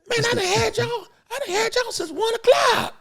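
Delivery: high squeaky put-on driver grumbling; limo driver's voice. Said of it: "he talk like this".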